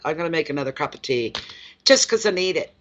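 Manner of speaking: ordinary delivery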